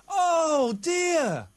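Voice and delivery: mock wail. Hammed-up grief over Davy Jones.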